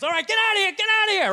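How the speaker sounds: high, loud get-out; trainer shooing. Retold or imitated. Trainer shooing the owner out, loud and high. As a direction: chase the owner away.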